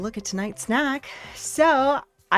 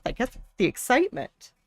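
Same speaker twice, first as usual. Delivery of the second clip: ordinary delivery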